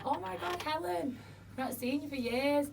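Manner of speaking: high-pitched imitation of delighted recognition; retold surprised greeting. Old workmate bumping into her after years, surprised and high.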